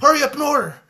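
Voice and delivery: rude manager yelling. Retold or imitated. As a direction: bark it out like a fed-up fast-food manager. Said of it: "And the manager was like".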